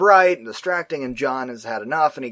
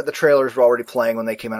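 normal talking voice

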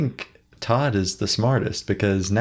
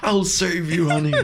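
high, hammed-up hero promising rescue; heroic husband voice; 8 semitones higher than usual. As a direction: play the gallant rescuer, laughing. Mock rescue of wife.

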